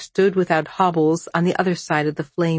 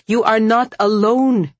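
louder, higher, insistent reproach; 4 semitones higher, 5 dB louder than usual. A narrator's reading. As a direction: throw the promise back forcefully. Throws his promise back at him.

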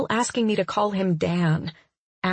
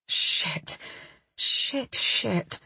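whispered curse; 3 semitones higher, 5 dB quieter, much slower than usual. Acted. Narrator panics in thought.

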